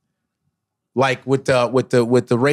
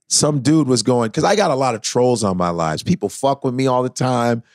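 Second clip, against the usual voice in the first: ordinary talk, nothing marked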